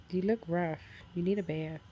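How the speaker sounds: ordinary delivery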